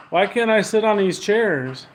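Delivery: loud, high question to an unseen presence; calling out. Real, not acted. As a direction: call the question out across the room.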